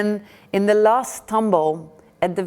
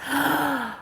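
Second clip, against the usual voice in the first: surprised gasp; 7 semitones higher, 4 dB quieter than usual. Acted. Pilgrim hears a voice answer.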